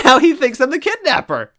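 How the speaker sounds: high, loud amused; laughing trickster. Acted. Toad to the heroes, laughing. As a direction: giggle it out.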